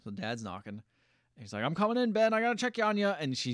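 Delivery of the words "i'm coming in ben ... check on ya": higher, lightly acted dad calling through a door. Dad knocking at his son's door, lightly mimicked calling out. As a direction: call in to your son, pitched up a little.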